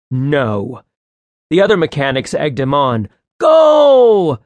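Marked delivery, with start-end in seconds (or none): 3.4-4.4 high, loud shout; much higher, louder cheer; egging on. A narrator's reading. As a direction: yell him on. Mechanics egging him on.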